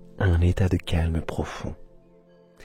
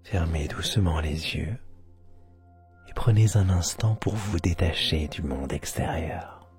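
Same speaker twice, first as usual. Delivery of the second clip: guided meditation; 3 semitones lower, 4 dB quieter than usual. Acted. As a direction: guide them into sleep, half whispering.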